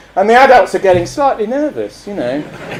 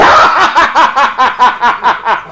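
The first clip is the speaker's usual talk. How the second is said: long, high villain cackle; Punch's drawn-out mad laugh; chilling laugh; 7 semitones higher than usual. Acted. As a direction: cackle on and on in a staccato run. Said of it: "in a chilling sort of a way".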